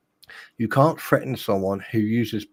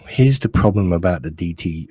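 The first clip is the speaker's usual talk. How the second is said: ordinary talk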